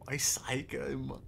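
hushed whispered question. Real, not acted. Watching tensely, a whispered question. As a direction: breathe the words, almost silent.

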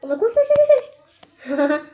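high, loud koş koş koş with laughter; laughing frantic cheer. Real, not acted. Girl urging her game character to run, laughing and shrieking. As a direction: urge your runner on, half laughing.

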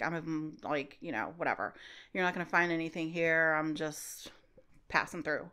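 low, soft, dismissive brush-off; brushing them off. Retold or imitated. Vagabond lady brushing off the agents, low and soft. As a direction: mumble it low like you want them gone. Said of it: "She doesn't wanna talk".

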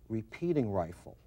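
ordinary talk

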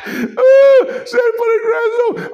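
high, loud mock cry; mock wail. Mock cry calling Shannon.

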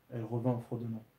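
ordinary delivery, nothing marked

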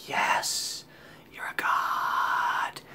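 slow, reverent, unvoiced whisper; hushed, drawn-out mock adoration; grateful whisper. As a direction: whisper it in worshipful relief. Silently grateful to the rescuing friend.

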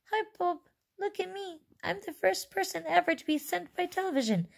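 very high, small mouse-like squeak; tiny squeaky voice. Acted. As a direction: pipe up in a tiny voice. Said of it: "no louder than a squeaking of a mouse".

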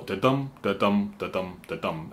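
da-dum with dum stressed; stress on the second beat. Shown as a demonstration. Songwriter showing the line of four, dum stressed. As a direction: lean on dum each time. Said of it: "the emphasis goes on the second syllable".

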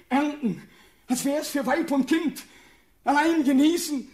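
high, loud, mounting desperation; frantic anguish. Acted. Condemned prince picturing a life of lonely toil, frantic anguish. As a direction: drive it upward until the voice nears tears.

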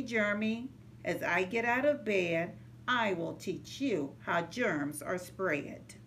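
ordinary delivery

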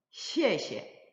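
시에시에, first syllable falling sharply from high, second short and light. Shown as a demonstration. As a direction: say "시에시에" as high fall, then a light neutral tone. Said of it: "이 시에시에는 사성으로서". (앞 시에는 높게 떨어뜨리고, 뒤 시에는 짧고 가볍게)

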